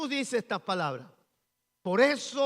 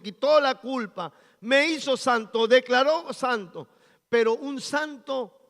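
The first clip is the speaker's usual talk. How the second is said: full-force preaching; 5 semitones higher, 8 dB louder than usual. Acted. Pastor preaching holiness to the congregation, loud and fervent. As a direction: preach it out to the whole church.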